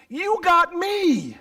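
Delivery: high, slow tender "got"; tender slow plea. Acted. Father to son, tender. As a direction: hold on "got" lovingly.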